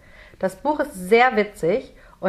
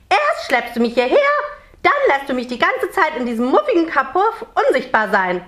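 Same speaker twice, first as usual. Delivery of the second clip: high, indignant scolding little creature; angry; 7 semitones higher, 3 dB louder than usual. A narrator's reading. Merman scolds Jonathan for dragging him here, angry. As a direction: rattle off grievances in a raised, cross voice. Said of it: "sagt der Nix böse".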